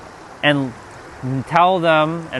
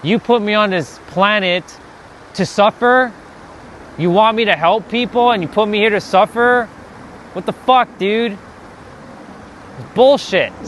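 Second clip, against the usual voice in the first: ranting at God; 5 semitones higher than usual. Retold or imitated. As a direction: demand answers from God, fed up. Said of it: "I would be talking like this".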